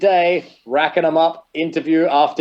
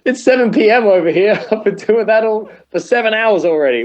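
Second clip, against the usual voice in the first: normal talking voice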